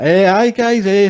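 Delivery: high-pitched, loud mock greeting; Bisping trying to speak. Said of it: "Bisping was trying to speak, and he's like".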